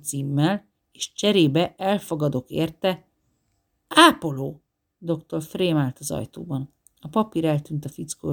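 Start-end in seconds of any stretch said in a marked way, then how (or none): 3.9-4.6 loud sharp shout of one word; sharp call. A narrator's reading. Doctor in the doorway calling the orderly, loud sharp shout. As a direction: snap it out to catch him.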